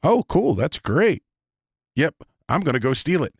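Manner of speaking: eager player. Retold or imitated.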